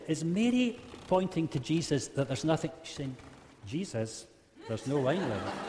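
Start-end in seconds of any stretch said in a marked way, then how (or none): 3.7-5.6 disappointed. Retold or imitated. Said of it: "is Mary disappointed?".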